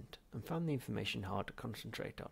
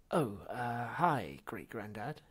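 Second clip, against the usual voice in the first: nervous breath; 6 dB louder, slower than usual. Acted. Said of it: "he breathed".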